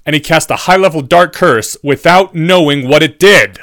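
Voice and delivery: loud, scathing professor's tirade; uncontained fury. A narrator's reading. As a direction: rage at the stupidity, hammering words loudly. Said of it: "the fury on Professor Quirrell's face was no longer contained".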